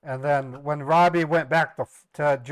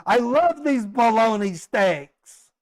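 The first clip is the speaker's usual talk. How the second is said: higher, slow, silly put-on voice; mock clueless guest; 8 semitones higher, 4 dB louder, slower than usual. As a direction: ham up the clueless diner. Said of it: "someone prepares a nice ribeye".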